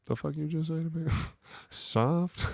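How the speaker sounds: high, laughing mock outrage; hammed-up indignation; offended disbelief. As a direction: act insulted at being called soft. Offended at being called soft.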